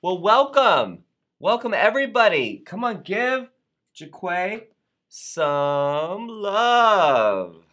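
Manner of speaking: loud, high, slow cheering welcome; big welcome. Real, not acted. Welcoming a new member loudly.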